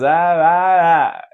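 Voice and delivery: mock baby babbling the melody of a word; imitating a baby. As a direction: babble "ah ah ah" like a baby copying the tune. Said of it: "a criança faz".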